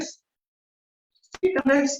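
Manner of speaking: high, slow, drawn-out question; quoting teammate. Retold or imitated. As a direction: stretch it out high like a pointed question. Teammate's reply quoted.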